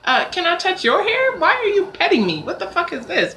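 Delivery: high, mock eager stranger asking to touch hair. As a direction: squeak it like a nosy stranger, then turn on them. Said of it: "or be".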